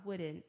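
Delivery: soft, low "wouldn't" with no T; American "wouldn't", T dropped softly; silent T. Shown as a demonstration. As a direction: end "wouldn't" on N, leaving the T unsaid. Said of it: "O T nem fala".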